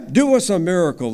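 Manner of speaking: loud, fast, pushy demand; demanding crowd. Acted. The crowd demands a miracle from Jesus, loud and fast. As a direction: shout it at him like a crowd that wants proof.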